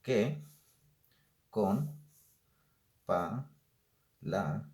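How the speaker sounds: word by word with long pauses; teacher scanning the third verse slowly; slow verse reading. Shown as a demonstration. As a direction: read the verse slowly, one word at a time. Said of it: "Terminamos aquí con el tercer verso".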